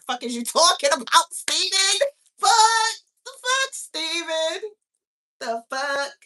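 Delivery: high, loud burst of disbelief; incredulous outburst. Real, not acted. Exasperated woman asking Steven what he's talking about, high and incredulous. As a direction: let exasperation at Steven push the pitch up. Outraged reaction to Steven's question.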